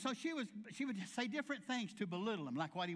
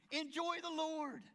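higher, slow, ringing call to joy; joyful exhortation; 8 semitones higher, slower than usual. Real, not acted. Preacher urging the congregation to enjoy the Lord, higher and slower. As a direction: lift it up high and slow.

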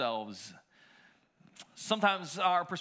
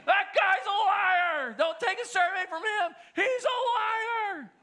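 retold yelling; 14 semitones higher, 5 dB louder than usual. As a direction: bellow the accusation across the mall. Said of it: "just yelled".

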